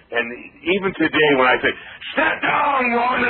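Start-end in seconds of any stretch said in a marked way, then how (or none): 2.2-3.3 roaring command, pitched way up; booming shout. Shown as a demonstration. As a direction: bellow it at the third row like a wrestler. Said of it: "I always had that loud voice".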